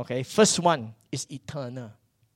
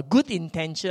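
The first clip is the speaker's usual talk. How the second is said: ordinary talk, nothing marked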